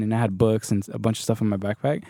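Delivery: ordinary delivery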